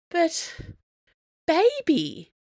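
high, pleading objection; whiny, drawn-out protest. A narrator's reading. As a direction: plead with a partner, pitch well up. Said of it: "Serenity protested".

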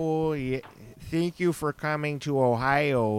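put-on Cleveland accent. Comic retelling of Cleveland comedy show hosts, in a Cleveland accent. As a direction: mimic a friendly Cleveland local's accent. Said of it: "they all talked like that".

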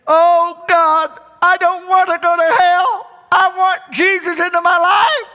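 high, loud desperate plea; loud pleading prayer. Retold or imitated. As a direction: cry out to God for mercy. Man begging God, crying.

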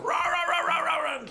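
rapid run of high mock barks; high-pitched yapping imitation; imitated dog barking. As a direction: bark on and on like an excited dog. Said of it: "And Humphrey's going".

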